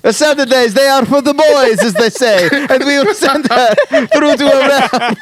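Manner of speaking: put-on jokey Boston sports fan boasting in a Boston accent; mock Boston accent.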